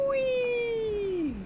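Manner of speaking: long, high-pitched wheee; high sustained whee of mock glee; playful whee. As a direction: squeal a drawn-out "whee" like riding a slide. Imitates zooming along the banked track.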